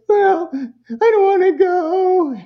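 put-on high, slow, tearful little-kid wail; crying kid. Child crying to the parent at drop-off, high tearful wail. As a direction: whimper it like a child about to cry. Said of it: "when the kid says".

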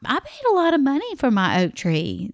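high and insistent; mild retold indignation; indignant protest. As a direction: push back hard on the paid price. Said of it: "I was like".

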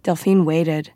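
plain reading voice

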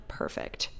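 soft unvoiced whisper of perfect. Tipsy passenger whispering to herself on the plane. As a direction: breathe it out to yourself.

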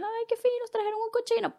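put-on a little girl thrilled by the new pig; delighted child voice.

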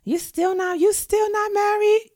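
shrill church-lady disbelief; nosy auntie. Retold or imitated. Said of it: "Like, you know, like".

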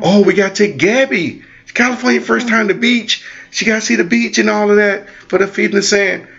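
high, eager quoting of the plan; mock excited. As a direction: play it up like an eager parent. Mock excited parent quoting plans.

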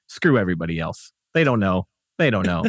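low-pitched mock defiant grumble; dismissive townsfolk. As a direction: play locals shrugging it off, pitched low. Voices the townspeople's defiant reply.

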